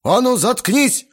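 loud, high bellowed order; furious yell. A narrator's reading. Guest loses temper at teenager's jibe, furious yell. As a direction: yell to silence the boy. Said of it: "заорал Грохотов".

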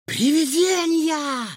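very high, stretched-out squeal; delighted yell. A narrator's reading. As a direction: shriek it with delight, holding the vowels. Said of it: "восторженно завопил Егор".

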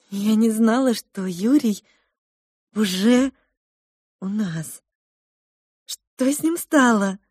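a hint of a worried older woman, slow; gently asking what became of him; lightly voiced cook mother. Cook mother to the children, mildly worried.

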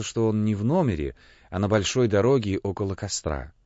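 ordinary delivery

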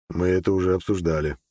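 ordinary delivery, nothing marked

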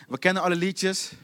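low, toneless going through the motions; bored, by-rote recital; monotone. Acted. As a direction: drone it mechanically on one low pitch. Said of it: "maar het is monotoon geworden".

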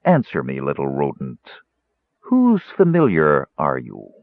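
loom over him and demand; stern boom. A narrator's reading. Mage questions the mouse, stern and low. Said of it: "boomed a stern voice".

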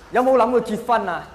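ordinary delivery

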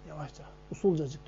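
hafifçe alçalan, çok kısık ses; soft, hushed aside evoking a stealthy exit; usulca. Retold or imitated. Evden sessizce çıkışı anlatıyor. (yavaşça, kısık sesle)

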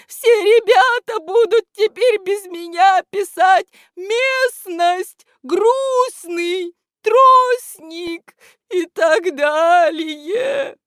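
loud, high, tearful lament; плач. A narrator's reading. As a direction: wail the list out, stretching the endings. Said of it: "в голосе у этого невидимого слышались слёзы". (громко причитать со слезами)

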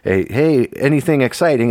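ordinary talk, nothing marked

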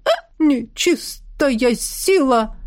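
slow startled cry of fear; frightened cry. Acted. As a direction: recoil as you cry out. Tikhon frightened by the witch.

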